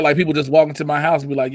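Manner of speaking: normal talking voice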